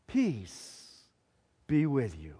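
low, soft, spacious blessing; calm greeting. A narrator's reading. Jesus greets the frightened disciples, calm and low. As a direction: say it gently, low and hushed.

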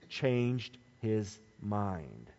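"Changed his mind" very slow, low and soft; drawn-out, hushed stress on "Changed his mind"; slow emphasis. Shown as a demonstration. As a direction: weigh each word of "Changed his mind". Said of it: "That's the emphasis point".

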